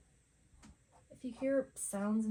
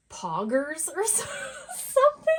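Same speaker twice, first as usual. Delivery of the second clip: laughing at slang; 8 semitones higher, 11 dB louder than usual. Real, not acted. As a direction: stumble over the word and crack up. Tries the teen slang word.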